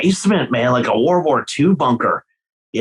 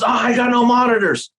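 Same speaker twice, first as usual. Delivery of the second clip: mock singer complaint; 11 semitones higher than usual. Mocking a whining singer.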